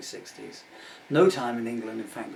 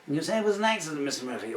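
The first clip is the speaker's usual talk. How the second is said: a loud, higher judge cross-examining; stern judge; 6 semitones higher, 6 dB louder, faster than usual. Acted. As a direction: boom it across the courtroom. Acted courtroom, judge questioning Murphy.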